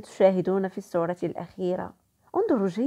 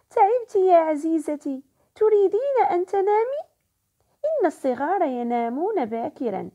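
put-on higher girl voice soothing her doll; little girl voice; 6 semitones higher, 4 dB louder than usual. Girl talks softly to her doll.

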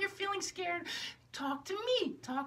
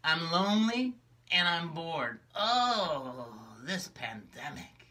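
much lower, slow, mopey puppet voice; gloomy low puppet; slower than usual. Acted. Magenta tells her sister how lonely she is, low and gloomy. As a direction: sigh it out like a sad puppet.

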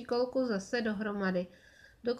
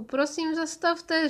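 higher-pitched, slightly raised appeal; calling to drivers; 6 semitones higher, 3 dB louder than usual. A narrator's reading. Chase to drivers at the crossing, calling out. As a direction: call traffic to a halt.

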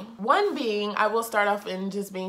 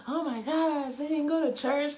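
mock shocked gasp; 5 semitones higher than usual. Mocking her younger shocked self.

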